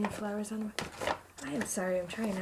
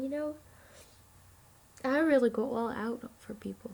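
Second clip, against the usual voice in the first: normal talking voice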